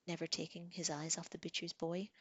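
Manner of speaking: usual speaking voice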